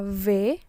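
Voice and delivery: "V" as a firmly voiced "vé"; French hard V. Shown as a demonstration. Teacher showing how V is read, firmly pressed sound. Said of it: "ditekan tebal".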